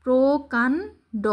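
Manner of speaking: প্রকাণ্ড drawn out slowly, syllable by syllable; slow careful word. Shown as a demonstration. Teacher modelling a joined-letter word, slowly. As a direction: stretch প্রকাণ্ড slowly for the learner. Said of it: "ড সংযুক্ত".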